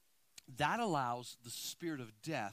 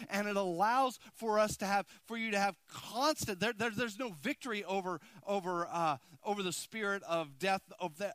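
raised, loud preaching voice; 6 semitones higher, 6 dB louder than usual. Acted. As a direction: preach it out to the congregation. Preaching at full force.

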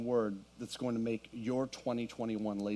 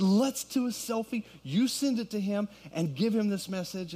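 excited; 8 semitones higher, 4 dB louder than usual. Retold or imitated. Retelling asking for a selfie.

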